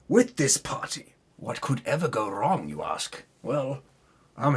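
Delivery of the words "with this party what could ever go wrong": usual speaking voice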